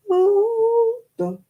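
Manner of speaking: very high, held-out first word; gleeful high singsong; singsong triumph. Real, not acted. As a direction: croon it high in celebration. Celebrates the finished knitting.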